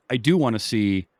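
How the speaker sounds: ordinary talk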